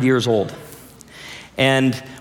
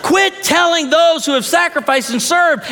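fired-up preaching; 12 semitones higher than usual. Real, not acted. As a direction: drive it home to the congregation. Preacher fired up, urging congregation.

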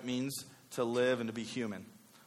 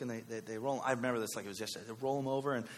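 ordinary talk, nothing marked

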